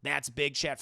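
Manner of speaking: ordinary delivery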